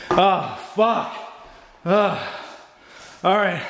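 ordinary talk, nothing marked

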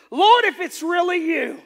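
high, loud, drawn-out call. Acted. Peter calls to Jesus from the boat, calling out. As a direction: holler it slowly as if across open water. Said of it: "then Peter called to him".

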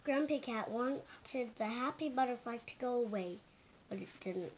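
ordinary delivery

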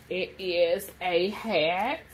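slow, stretched-out goofy announcement; odd drawn-out voice. Real, not acted. Showing her haul to viewers, slow goofy announcing voice. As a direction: drag out each word in a silly put-on voice. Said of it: "I don't know why I said it like that".

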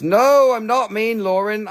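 indignant but friendly objection; playful protest. Real, not acted. Seller defends self to Lauren, playful protest.

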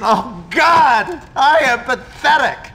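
anguished shout. Acted. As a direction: yell at Jimmy to go away, near breaking. Distraught teacher yells Go away.

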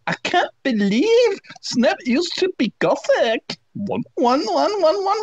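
high, slow squeaky voice; high fangirl voice. Acted. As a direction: squeak it like a giddy teen. Reading fanfic in high voice.

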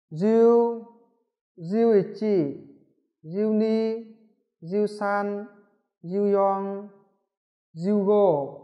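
jiu numbers spaced out, slow; teacher drilling numbers one by one; slow counting drill. Shown as a demonstration. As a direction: read each number for students to repeat. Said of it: "tôi sẽ đọc".

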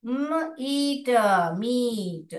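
मीट split as म, ईट, then blended into मीट with long ee; sounding out. Shown as a demonstration. Teacher blending meat for the class. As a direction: build the word from its sounds. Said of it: "मैं यहाँ पर ऐसे कर रही हूँ".

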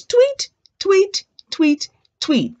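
four very high imitated tweets; high, short birdie peeps. Shown as a demonstration. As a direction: chirp four times like little birds. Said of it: "the birds say".

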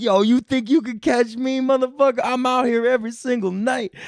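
high mock-tough challenge; cocky taunt. Comedian roasting the bald guy in the front row, cocky high taunt.

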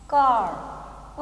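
"कार" stretched very slowly, long "aa" with the r silent; slow stretched demo of "कार"; drawn-out long aa. Shown as a demonstration. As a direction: draw out "कार" slowly, drop the r. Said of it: "वो sound आती नहीं".

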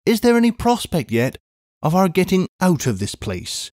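a brisk English housekeeper calling out; a hint of a bustling maid; Miss Pross.

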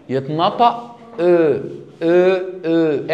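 "او" slowly and round, drawn out; French o sound. Shown as a demonstration. Teacher drills "او" slowly for students.

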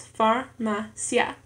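slow "farmacia" in three beats, far, ma, cia; syllable by syllable. Shown as a demonstration. Learner showing three syllables in an exercise, "farmacia" syllable by syllable. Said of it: "en tres sílabas".

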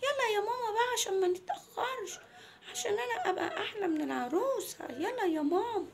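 put-on high, whiny child voice; little girl Mona. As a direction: plead with mum like a small girl. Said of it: "قالت لها". (صوت بنت صغيرة بتتحايل على مامتها)

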